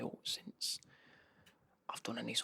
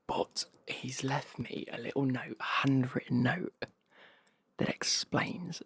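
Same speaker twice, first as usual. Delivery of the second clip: loud, eager whisper; excited whisper; 8 dB louder, slower than usual. Real, not acted. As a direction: lean in, thrilled whisper. Thrilled about a handwritten note.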